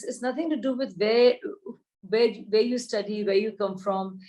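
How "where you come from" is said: ordinary talk, nothing marked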